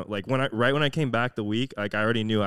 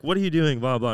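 higher, louder retold scolding; mock angry mom; 6 semitones higher, 6 dB louder than usual. Mom walking into a splattered kitchen, mock angry. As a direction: imitate a mom getting mad. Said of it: "she got mad at some".

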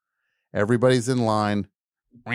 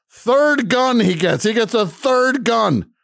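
exasperated; 13 semitones higher, 6 dB louder, slower than usual. Retold or imitated. Exasperated retelling, third gun.

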